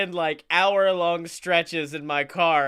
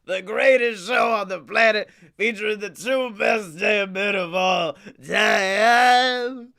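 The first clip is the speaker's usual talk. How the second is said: booming ringmaster announcement, much louder; 3 semitones higher, 3 dB louder than usual. Pretend. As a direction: hype the crowd like a circus ringmaster. Announcing the show intro.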